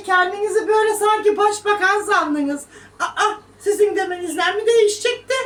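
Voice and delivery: high, loud mocking; mocking scorn. Real, not acted. Mocking commenters.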